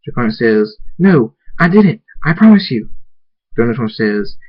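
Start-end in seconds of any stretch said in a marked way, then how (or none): 1.0-2.9 high, loud plea of innocence; urgent protest. Acted. Draconic denies hurting Dashy to raging Thunderstorm, urgent loud protest.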